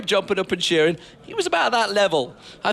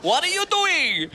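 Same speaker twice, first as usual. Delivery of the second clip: high, slow hammed-up Italian accent; mock Italian accent; 10 semitones higher, slower than usual. Analyst teasing his colleague in a high, slow mock Italian accent. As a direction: stretch it out in a cartoon Italian accent. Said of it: "why you go all Italian".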